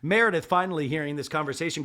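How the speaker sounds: ordinary talk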